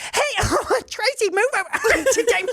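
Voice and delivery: put-on high, giggling kids on the mic; giggly teen friends. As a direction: squeal it like silly teens on the intercom. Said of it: "they're like".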